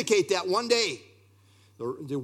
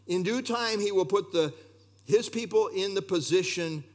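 ordinary talk

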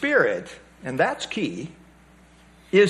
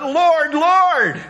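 emphatic Lord Lord; 6 semitones higher than usual. Retold or imitated. Preacher imitating showy worshippers, fervent raised cry. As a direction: call out Lord twice with put-on zeal. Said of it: "It's repeated for emphasis".

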